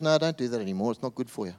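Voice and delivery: soft, gentle voice of forgiveness; tender Jesus. Acted. Jesus speaking to the adulterous woman, soft and tender. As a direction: speak it softly, like comforting someone ashamed. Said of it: "He just goes".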